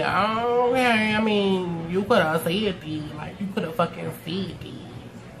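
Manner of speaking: muttered aside. Retold or imitated. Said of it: "I'm like".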